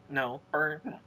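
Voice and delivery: speaking with hiccups. Real, not acted. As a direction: talk through the hiccups. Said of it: "I have the hiccups".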